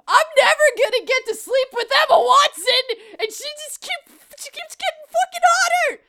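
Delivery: high-pitched, exasperated mock rant; mock outrage. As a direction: climb into a worked-up complaint.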